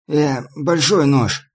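shouting. A narrator's reading. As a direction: call out in protest. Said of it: "крикнул Мармат".

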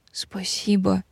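soft, near-whispered thanks; hushed thanks. A narrator's reading. Moved heroine thanking her dragon friend, hushed. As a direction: breathe it out barely voiced.

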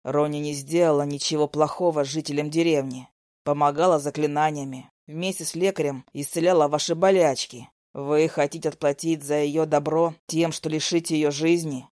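a lightly voiced young man, low; low male voice. A narrator's reading. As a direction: voice Zak low and calm.

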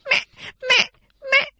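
very high sobbing plea; crying children begging. A narrator's reading. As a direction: wail and beg like terrified little girls. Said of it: "khóc tấm tức". (các chị khóc van xin mẹ, giọng rất cao)

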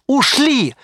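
high, elated cry; excited yell. A narrator's reading. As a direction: call out the escape in relief. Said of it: "крикнул Ривз".

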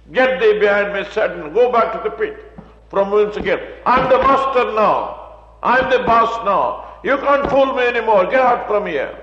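loud, high commanding rebuke; rebuking Satan. Acted. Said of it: "you stand on the scripture".

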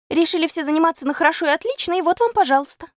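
high, hurried complaint; indignant classroom outcry. A narrator's reading. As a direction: call it out high and fast. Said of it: "Но отдельные выкрики".

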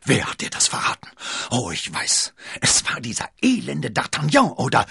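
heiser vor Wut, laut gepresst; wütend und heiser herausbrüllen; heiseres Aufbrüllen. A narrator's reading. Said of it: "Milady brüllte heiser auf". (heiserer Wutausbruch)

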